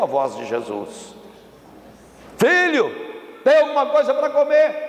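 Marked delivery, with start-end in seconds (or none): 2.4-4.8 high, carrying call. Acted. Jesus calls Peter about food, calling out. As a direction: call to someone out on the water.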